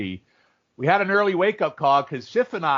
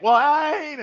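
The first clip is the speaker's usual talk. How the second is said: mock Southern accent; 13 semitones higher, 6 dB louder than usual. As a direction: tease the fan in a hammed-up Southern accent. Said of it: "I would be like".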